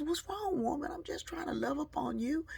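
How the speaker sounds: high, whiny protest; husband protesting after being kicked out of bed; indignant husband. Retold or imitated. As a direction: plead your innocence to the wife.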